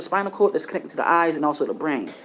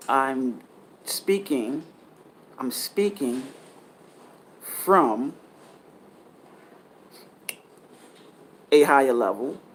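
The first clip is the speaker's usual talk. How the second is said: altered channeling voice; slower than usual. Real, not acted. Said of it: "my voice is not, does not sound like this".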